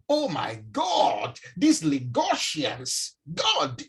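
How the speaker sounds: drawn-out mock exasperation; slow, stretched-out grumbling; complaining commentary. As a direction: imitate a driver griping at everyone. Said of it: "He runs commentary".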